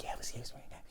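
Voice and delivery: near-whispered mock reply; barely audible retold aside; hushed imitation. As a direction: drop to a whisper to imitate her. Teller voices the woman's reply.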